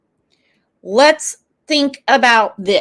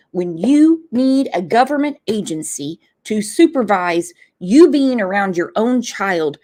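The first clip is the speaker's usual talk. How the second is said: usual speaking voice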